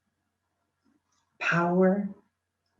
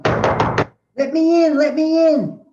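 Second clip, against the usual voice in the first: high, slow, louder calling to be let in; slow, high, louder plea of second bloom; pleading call; 10 semitones higher, 6 dB louder, much slower than usual. Acted. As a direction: call out slowly and high like something knocking.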